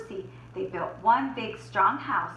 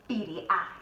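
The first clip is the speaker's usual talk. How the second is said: usual speaking voice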